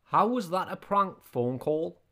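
ordinary talk

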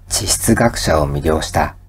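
ordinary talk, nothing marked

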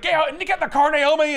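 high, loud mock command; hammed-up furious mother; angry mom. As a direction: bark at the daughter to get in the car.

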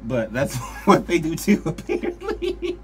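talking through high giggles; can't stop laughing mid-sentence. Real, not acted.

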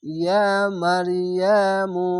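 slow, drawn-out chanted tajweed reading; melodic Quran recitation. Shown as a demonstration. Teacher models the verse for students, slow melodic recitation. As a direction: chant it slowly, stretching the vowel. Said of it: "এক আলিফ টেনে পড়বেন".

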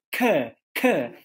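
"k" opened into a short stressed "kuh"; carrot vowel. Shown as a demonstration. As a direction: model "k" with the carrot vowel, twice. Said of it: "cor é com carrot".

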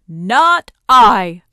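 loud, emphatic gorilla refusal; big gorilla. A narrator's reading. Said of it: "said the big gorilla".

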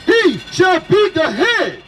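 ordinary delivery

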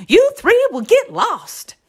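very high, loud, worried warning; papa's warning cry. A narrator's reading. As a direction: cry after the little ones. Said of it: "cried their papa".

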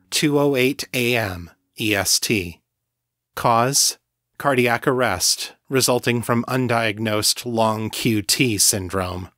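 ordinary delivery

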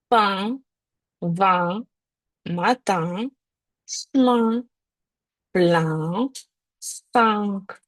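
words said with the nasal «ен» in a wide smile; nasal en sound. Shown as a demonstration. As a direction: spread the lips and say the words through the nose. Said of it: "найяскравіші слова з цим звуком". (слова з носовим «ен» у посмішці)

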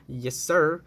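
ordinary talk

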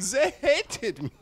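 high, slow mock Swedish accent; drawn-out Swedish complaint, hammed up; Swedish kid accent. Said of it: "Just some fucking Swedish".

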